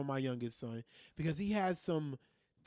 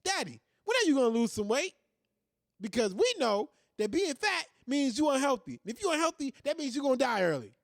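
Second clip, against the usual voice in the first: put-on higher, louder kid voice; light kid impression; imitating his kids; 7 semitones higher, 5 dB louder than usual. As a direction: play the blunt child lecturing dad. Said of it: "They ask me".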